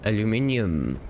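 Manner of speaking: "aluminium" with five syllables, ending in -ee-um; British pronunciation. Shown as a demonstration. Player naming the factory's metal to viewers, "aluminium" ending -ium. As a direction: say "aluminium" with an extra i before the final um. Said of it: "or aluminum or aluminium".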